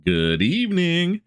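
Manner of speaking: high, slow, drawn-out movie greeting; Hitchcock-style greeting. Retold or imitated. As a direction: stretch it out like an old TV host. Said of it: "different movie quotes coming out of it".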